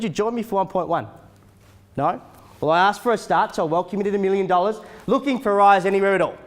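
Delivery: loud, rapid auctioneer calling; auctioneer calling bids. Acted. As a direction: rattle off the bids to the crowd. Live auction bidding calls.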